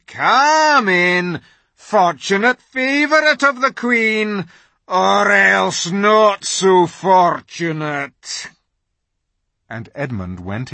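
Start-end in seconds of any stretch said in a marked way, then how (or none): 0.1-8.5 slow, mocking wolf voice; a sly threat in a welcome; mocking wolf, slowing at the end; sneering wolf. A narrator's reading. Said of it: "came bounding back and said".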